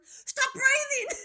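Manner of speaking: high, slow, laughing yell; laughing mock scream. Screaming at friends in a stuck lift, laughing mock scream. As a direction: shriek it through laughter, hammed-up. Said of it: "I screamed out to them".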